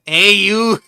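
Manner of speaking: badly faked Brooklyn yell; bad Brooklyn accent. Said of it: "But I can't do a Brooklyn accent".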